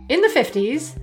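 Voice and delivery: normal talking voice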